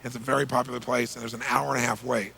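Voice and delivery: ordinary delivery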